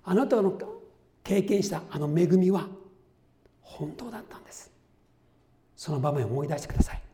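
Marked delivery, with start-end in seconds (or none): 3.6-4.7 soft, hushed insistence; earnest whisper. Real, not acted. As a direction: whisper it as a precious truth. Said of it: "emphatic whispered truth". (ささやくように真実を伝える)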